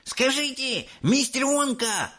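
put-on high, pushy kid's voice; a boy pestering the adult; eager boy shouting. A narrator's reading. Mike calling to Mr Wonka, eager. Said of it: "закричал он".

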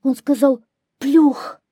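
high, loud little hare; grey hare answering. A narrator's reading. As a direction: blurt it out as a scared hare. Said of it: "ответил серый заяц". (заяц отвечает высоко и громко)

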